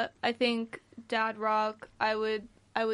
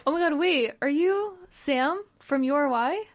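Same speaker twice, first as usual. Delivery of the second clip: mock starstruck fan; 5 semitones higher, 5 dB louder than usual. Co-host pretending to recognise Sam from the station, mock starstruck. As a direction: gush like you just spotted a radio star.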